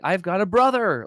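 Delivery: thrilled announcement, voice lifted; retold delight. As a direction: relive the thrill of a new brother. Recalls delight at finding a brother.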